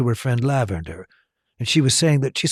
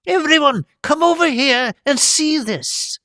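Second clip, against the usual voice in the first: much higher, excited squeaky delight; gleeful little professor. A narrator's reading. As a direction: cry out with gleeful praise. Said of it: "cried Professor Flitwick gleefully".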